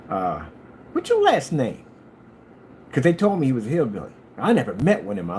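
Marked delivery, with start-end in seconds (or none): 0.9-1.9 sudden high, louder curious ask; imitating himself blurting out the question. Asking the hillbilly his last name, high and curious. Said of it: "I was like, um".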